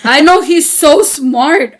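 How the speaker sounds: usual speaking voice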